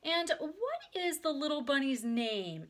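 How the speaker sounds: put-on high, slow baby-talk question; cooing grown-up. A narrator's reading. Friend asks little Ramona her name, high and cooing. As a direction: coo it to a tiny child. Lady cooing at a small child.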